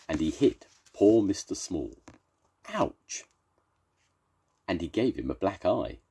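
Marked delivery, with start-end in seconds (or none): 2.7-3.2 high squeaky ouch. Acted. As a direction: yelp of pain, very high. Mister Small is hit.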